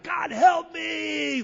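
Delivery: high, drawn-out mock scream; retold scream. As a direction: cry out for help, stretching it high and slow. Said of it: "I just screamed out".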